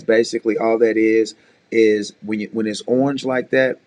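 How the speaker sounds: usual speaking voice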